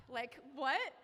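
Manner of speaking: loud, high incredulous outburst. Retold or imitated. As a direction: exclaim it in loud disbelief. Baffled at having to climb boulders.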